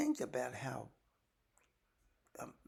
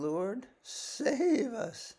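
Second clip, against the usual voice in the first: slow, drawn-out cry for help, pitched high; desperate plea; 9 semitones higher, 7 dB louder, much slower than usual. Acted. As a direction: beg to be saved, stretching the words. Disciples cry out in storm.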